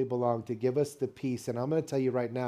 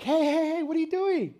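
put-on high-pitched, urgent "hey, hey, hey" to a child; alarmed calling out; 3 dB louder than usual. Grandfather catching his grandson climbing into the refrigerator, alarmed calling out. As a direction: call out in a much higher voice to stop a kid.

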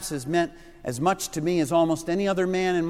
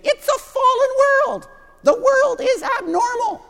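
much higher, louder squeaky preacher cry; Doctor Schaeffer's squeaky yell; 7 dB louder than usual. Retold or imitated. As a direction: shout it way up high like the excited old preacher. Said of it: "he would yell out about this world. He would say".

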